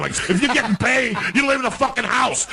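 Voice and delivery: high, heated outburst; angry rant. Real, not acted. Ranting at minimalist houses.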